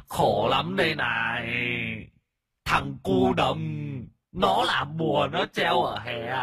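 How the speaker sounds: high, slow, drawn-out tearful wail; whimpering ghost wailing. A narrator's reading. A ghost laments his misery to the master, tearful wailing. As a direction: moan out every word as a blubbering spirit. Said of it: "mếu máo ôm mặt khóc tu tu".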